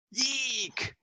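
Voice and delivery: sharp delighted squeal; high squeal. Acted. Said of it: "She squealed in delight".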